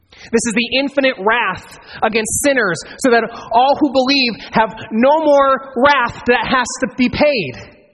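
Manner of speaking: rising fervent sermon peak; preaching at full force. Acted. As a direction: drive the point home from the pulpit. Preacher builds to climax.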